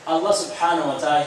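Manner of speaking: usual speaking voice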